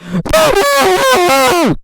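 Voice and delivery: high shrieking laugh; loud high-pitched cackle; yelling laugh. Acted. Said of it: "as she yelled".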